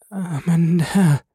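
high, slow, trembling murmur; wife murmuring in fear; fearful murmur. A narrator's reading. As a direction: breathe the words out in dread. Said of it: "murmelte sie".

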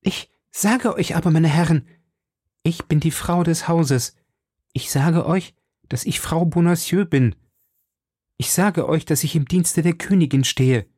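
trapped wife protesting to her captors, high and desperate; desperate woman crying out. A narrator's reading. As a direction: insist on it in rising panic. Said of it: "rief die unglückliche Frau".

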